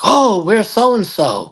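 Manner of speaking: high, slow mock neighbor; neighbor's voice. Neighbor at the door asking for her, high and slow.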